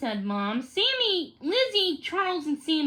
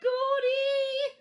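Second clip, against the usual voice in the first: high sing-song call across the room; 11 semitones higher than usual. A narrator's reading. Lizzie calling Goldie over, high sing-song call. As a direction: call the dog over in a lilt. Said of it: "she called".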